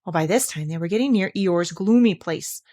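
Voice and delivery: usual speaking voice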